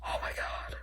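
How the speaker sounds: tearful whisper breaking into a cry; real crying whisper; choked-up cry. Real, not acted. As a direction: barely get it out through tears. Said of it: "I'm emotional".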